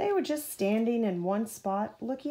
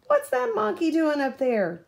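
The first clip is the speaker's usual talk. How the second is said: higher, louder indignant demand; demanding woman; 7 semitones higher, 7 dB louder than usual. A narrator's reading. Woman at the station demands about the monkey, indignant. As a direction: demand an answer, annoyed. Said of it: "demanded a woman".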